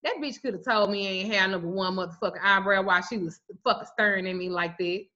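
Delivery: ordinary delivery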